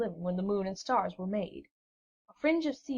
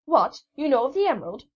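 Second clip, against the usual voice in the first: loud, sharp outburst of surprise; a young sailor's loud astonished cry; startled cry; 5 semitones higher, 9 dB louder than usual. A narrator's reading. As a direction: blurt it out in shock. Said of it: "cried the sailor".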